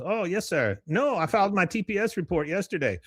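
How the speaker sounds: high-pitched eager underling on the phone; deferential employee. Retold or imitated. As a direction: placate the boss in a high voice. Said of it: "He goes".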